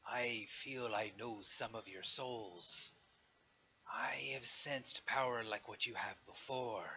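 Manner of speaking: slow, measured dragon voice; dragon speaking. Acted. Dragon addresses the adventurers before it, slow and solemn dragon voice. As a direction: draw it out as an ancient dragon, long pauses. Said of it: "kind of echo from all sides and say".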